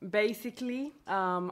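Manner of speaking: playful Brazilian-accented English. Shown as a demonstration. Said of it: "I'll put on the accent".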